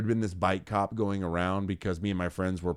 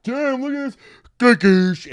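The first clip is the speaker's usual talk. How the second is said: high, loud, drawn-out gawk; exaggerated rubbernecking yell; acted gawking exclamation; 10 dB louder, slower than usual. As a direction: cry it out stretched and high, mid-crash. Retold rubbernecking wipeout, acted out.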